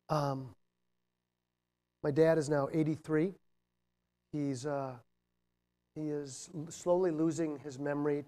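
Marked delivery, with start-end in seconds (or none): none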